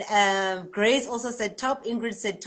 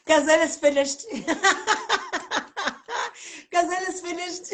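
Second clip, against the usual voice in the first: laughing, higher; laughing reading the comment; 8 semitones higher, faster than usual. Real, not acted. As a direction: crack up over the comment.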